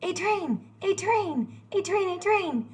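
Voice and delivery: high, calling out at a passing train; excited calling. Acted. Narrator spotting a train, high excited call. Train suddenly arrives in story.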